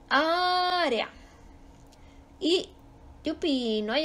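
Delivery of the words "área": área with a long, high Á; ÁÁÁ-re-a, slow and raised; stress on first syllable. Shown as a demonstration. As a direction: hold the Á of área high, then drop. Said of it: "su sílaba tónica está en la antepenúltima".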